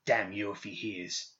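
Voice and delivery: slow, hushed, angry curse; spat curse. A narrator's reading. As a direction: curse him under your breath, nearly spitting. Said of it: "He nearly spat at the boy as he cursed".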